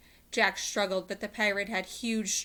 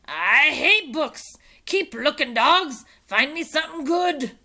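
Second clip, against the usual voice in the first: furious pirate rant; 8 semitones higher, 13 dB louder than usual. Acted. Captain rants.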